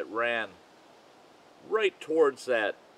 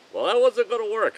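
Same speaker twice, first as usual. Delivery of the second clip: raised, louder, emphatic refusal to wait; defiant resolve; 9 semitones higher, 5 dB louder than usual. Retold or imitated. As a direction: say it like nothing will stop you. Decides to defy the wait.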